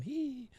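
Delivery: tiny high, soft "hee"; imitated background squeal. Narrator imitating Maria behind the phone call, high squeal. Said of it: "you hear Maria in the back going".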